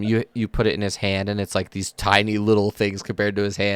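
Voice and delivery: normal talking voice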